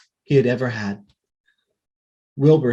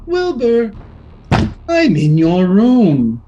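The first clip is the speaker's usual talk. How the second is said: loud, slow ghostly demand; 4 semitones higher, 5 dB louder, slower than usual. A narrator's reading. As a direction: loom over him, slow and loud.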